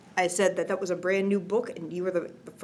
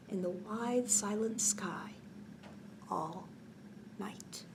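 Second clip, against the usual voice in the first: softer and softer to a breath; fading to a whisper; 6 dB quieter than usual. Acted. Moon's final bedtime lines, fading to whisper. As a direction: let the last words vanish.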